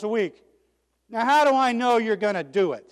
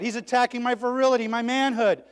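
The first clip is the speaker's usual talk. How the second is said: normal talking voice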